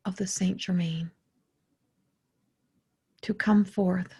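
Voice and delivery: measured meditation voice with long pauses; guided meditation. Acted. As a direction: invoke reverently, phrase by unhurried phrase. Leads a channeled meditation.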